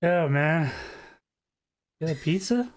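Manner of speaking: lightly voiced stoner, pitch lifting at the end; a hint of a laid-back stoner. Stoner asking the visitors at the door about pizza, lightly dopey. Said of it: "like, a stoner guy at the door".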